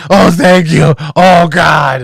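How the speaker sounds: loud, high, hammed-up cries of relief; relieved old lady. Old lady finally getting scratched, hammed-up relief. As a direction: moan and cry out in mock ecstasy. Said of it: "And she's like".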